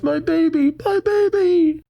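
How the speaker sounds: very high, thin mock cry of panic; hammed-up frantic mother squeal; mock shriek. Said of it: "The woman shouts".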